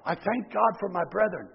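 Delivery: reflective repeat. Shown as a demonstration. Preacher quietly repeats Paul's verse to himself, softly. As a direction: let the verse sink in, hushed. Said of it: "what Paul says here in verse eight".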